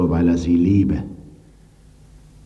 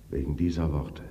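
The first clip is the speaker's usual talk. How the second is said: ordinary delivery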